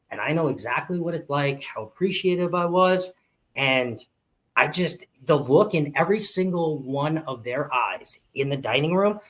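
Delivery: ordinary talk, nothing marked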